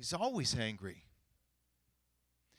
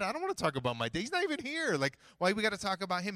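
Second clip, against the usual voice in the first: acted-out exasperation, louder, pitch climbing; indignant protest; 6 semitones higher, 4 dB louder than usual. Client objecting to coach's questions about his family, indignant. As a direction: protest it like a put-upon client, voice rising.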